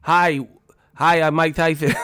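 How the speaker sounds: lisping boxer greeting; Mike Tyson. Retold or imitated. Streamer cracking up over the outtake, lisping boxer impression. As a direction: imitate the boxer's lisped introduction. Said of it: "this was the funniest shit".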